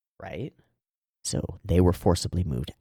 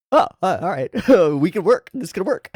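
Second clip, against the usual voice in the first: surprised; 10 semitones higher, 5 dB louder than usual. Retold or imitated. Acting out surprised agreement.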